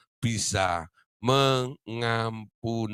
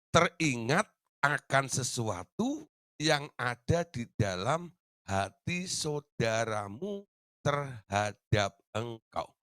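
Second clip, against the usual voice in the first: each word slow, dropping softer toward the end; slow emphatic reading; 3 dB quieter, much slower than usual. Shown as a demonstration. Preacher rereading the key verse to the congregation, slow and emphatic. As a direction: lean on every word of the verse. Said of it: "Perhatikan, Saudara, yang di headline ini".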